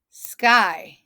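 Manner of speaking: SKY in one loud beat; snapped short. Shown as a demonstration. Teacher snapping syllables with class, SKY short and punchy. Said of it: "Snap it with me".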